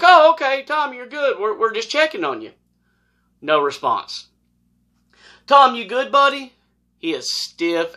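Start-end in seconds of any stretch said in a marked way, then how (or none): 0.0-2.5 high, loud calling to someone inside. Retold or imitated. Officer calling into the camper, high and loud. 5.5-6.5 high, louder call to a friend. Retold or imitated. Officer calling to the still man, high and loud.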